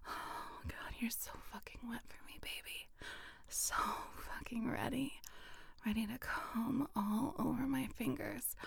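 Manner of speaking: soft fast unvoiced whisper; intimate whisper. Whispering urgently to a partner, intimate and soft. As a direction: hurry the breathy words close to the ear.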